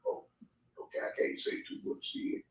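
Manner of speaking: hushed, backing off whisper; sheepish whisper. Retold or imitated. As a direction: whisper it like you got caught. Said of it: "and I'll be like".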